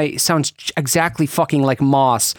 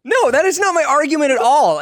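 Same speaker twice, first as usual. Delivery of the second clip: indignant protest; 11 semitones higher than usual. Real, not acted. Indignant denial in teasing argument.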